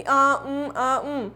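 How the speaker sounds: drawn-out "aa, umm" fillers; imitated hesitation. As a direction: mimic someone groping for words. Imitates hesitant speaker searching for words.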